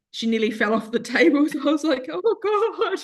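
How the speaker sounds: laughing through the words, pitch climbing. Real, not acted. Telling a friend about the dog nearly falling, laughing. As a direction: breaks into a high laugh mid-sentence.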